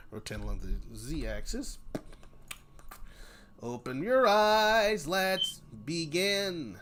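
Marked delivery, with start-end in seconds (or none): 3.6-6.8 slow, drawn-out mock intro, a bit higher; mock dramatic intro. Mocking a grand opening to begin a scene.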